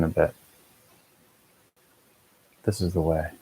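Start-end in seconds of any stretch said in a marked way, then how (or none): 2.6-3.3 softer, smooth and even mantra; soft equanimous mantra. Shown as a demonstration. Meditation guide softly models the mantra, smooth and calm. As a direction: let the words settle gently, softly. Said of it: "see if you can play with the tone and inflection".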